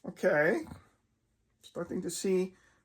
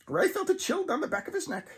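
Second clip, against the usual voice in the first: ordinary delivery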